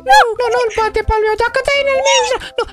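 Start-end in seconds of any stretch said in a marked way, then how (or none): none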